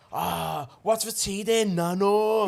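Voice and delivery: imitated Manc-Scouse lad. Lad asking his nan about tea, imitated Scouse slipping into Manc. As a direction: put on his accent and lift it on "nano". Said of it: "he talks full Scouse until he says his nan".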